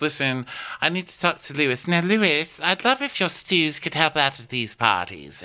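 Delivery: high-pitched, put-on boss request; Faye impression voice. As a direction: speak up high as Faye, asking a favour. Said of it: "Faye says".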